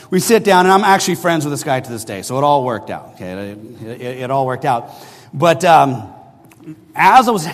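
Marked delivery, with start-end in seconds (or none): none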